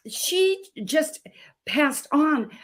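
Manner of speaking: slow, whispered, grieving; whispering the loss of her mother, slowly; hushed grief. Real, not acted. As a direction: let it fall to a whisper, slowly. Telling of her mother's death.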